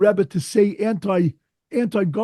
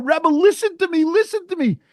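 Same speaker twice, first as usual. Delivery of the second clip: imitating a howling plea; 11 semitones higher than usual. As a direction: mimic a broken father begging through tears. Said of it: "howling on the floor".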